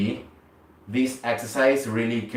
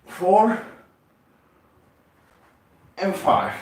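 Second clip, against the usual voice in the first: higher, louder strained count; straining on reps; 8 semitones higher, 5 dB louder, much slower than usual. Real, not acted. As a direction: push the count out through effort. Last reps of set.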